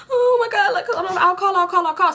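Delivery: high, tearful mock hysteria; babbling in a high-pitched fluster; frantic panic. Driver promising the pregnant woman help, frantic. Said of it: "I was going crazy".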